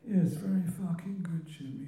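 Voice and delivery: high-pitched old-timer's mock telling-off; Len B impression. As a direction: imitate an old Croydon character catching his mimic. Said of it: "He was behind me".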